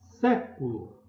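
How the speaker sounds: SÉ held long in Século; stressed first syllable. Shown as a demonstration. Teacher calling the word for pupils, stressed SÉ in Século. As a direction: draw out SÉ in "Século" and let it fall. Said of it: "Pra chamar a palavra".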